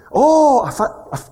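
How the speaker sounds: loud, high "oh", then stammering; acted-out dawning, blurted high; sudden realization. Pastor retelling when it dawned on him, sudden realization.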